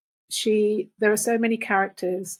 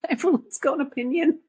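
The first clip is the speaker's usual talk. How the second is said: laughing while talking; 7 semitones higher than usual. Real, not acted. Laughing at village gossip and ME.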